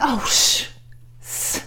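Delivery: Marilyn Monroe voice. Retold or imitated. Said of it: "she's like".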